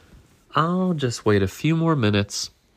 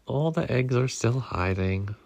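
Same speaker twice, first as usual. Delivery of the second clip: ordinary delivery, nothing marked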